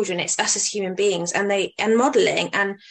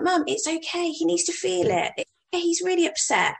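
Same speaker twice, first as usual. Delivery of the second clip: higher-pitched insistent reassurance to mum; acted-out daughter calmly objecting, pitched up; retold gentle protest; 7 semitones higher than usual. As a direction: plead lightly with an overeager parent. Answering her mother in retold scene.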